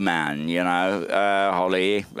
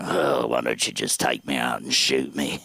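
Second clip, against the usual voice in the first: put-on weary old mom; 11 semitones lower than usual. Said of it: "She'd come out in the morning and say".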